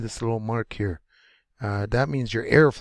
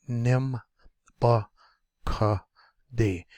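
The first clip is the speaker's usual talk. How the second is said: "nim, ba, ka, de" in separate pieces; syllable by syllable; slower than usual. Shown as a demonstration. Teacher breaking up a word for learners, syllable by syllable. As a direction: pause after each of "nim", "ba", "ka", "de". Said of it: "when M is next to a consonant".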